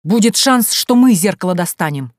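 loud, insistent answer; barked retort. A narrator's reading. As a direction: press the point forcefully. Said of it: "рявкнул Бажен".

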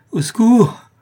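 high, loud desperate shout; cry for help. A narrator's reading. Poisoned accomplice calls for help, high loud cry. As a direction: yell out for rescue. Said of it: "cria le complice de Jacques Ferrand".

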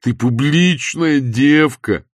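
slow, loud, high wail; drawn-out whining. A narrator's reading. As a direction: whine it out, stretching the vowels. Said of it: "тягуче заныл он". (тягуче ныть, громко и нараспев)

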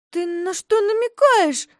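high, loud indignant question; offended girl. A narrator's reading. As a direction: bristle at the insult. Offended girl confronts bear.

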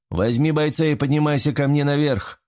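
mild narrator's shout; narrated shouted order. A narrator's reading. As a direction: give a restrained called-out command. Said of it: "закричал Буторин".

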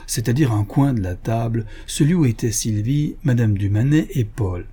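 ordinary delivery, nothing marked

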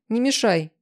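brusque warning to stay out of it; curt order. A narrator's reading. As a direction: cut the objection off. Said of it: "отрезала я".

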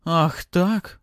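high, loud outburst; indignant, furious cry. A narrator's reading. Ella defies her father's order, with an indignant cry. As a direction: explode in outrage. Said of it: "возмущённо и яростно закричала Элла".

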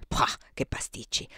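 gossiping whisper, no voice. Acted. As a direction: whisper the scorn like sharing a secret. Gossiping about Jenny's pies. (sussurro pettegolo di disprezzo)

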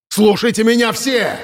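high-pitched calling cry. A narrator's reading. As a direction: call out high to everyone, acted. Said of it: "Он повысил голос до крика".

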